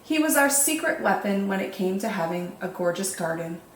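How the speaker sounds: ordinary delivery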